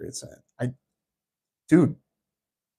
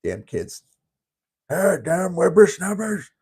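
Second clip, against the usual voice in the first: put-on old prospector; 6 semitones higher, 4 dB louder than usual. Grumbling at kids like old man.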